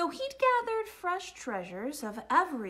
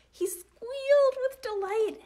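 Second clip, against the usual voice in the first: high, loud burst of delight; delighted squeal; 11 semitones higher, 7 dB louder than usual. A narrator's reading. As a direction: narrate it with a gleeful lift in pitch. Narrator enacts the squeal of delight.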